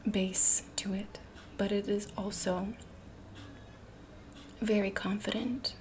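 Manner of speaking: ordinary talk, nothing marked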